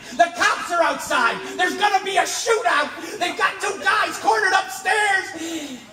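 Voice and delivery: loud, high, slow, laughing excitement; a thrilled cook blurting out the news with a laugh; excited cook. Retold or imitated. Cook bursting in about the shootout, laughing and excited. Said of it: "And he's real excited".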